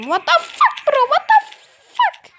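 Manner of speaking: high squeal of disbelief; shocked yell. Real, not acted. Gamer falling off the map, shocked squeal.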